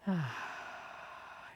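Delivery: soft, slow exhaled sigh; long relaxing sigh. Real, not acted. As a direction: let the breath out long and softly. Sighs deeply while leading meditation.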